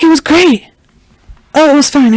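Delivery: high, breezy fake-casual reply; chirpy nervous brush-off; nervous cover-up. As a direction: sound too bright, hiding something. Daughter covers after secret appointment.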